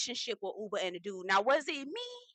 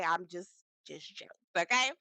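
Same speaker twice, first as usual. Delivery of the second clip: ordinary talk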